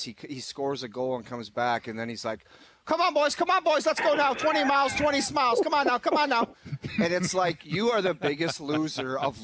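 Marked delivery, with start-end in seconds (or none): 2.8-6.5 high, loud rah-rah cheerleading; fake hype. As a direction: pump up the bench, way up high. Pouting player suddenly hyping team.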